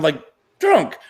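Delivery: ordinary delivery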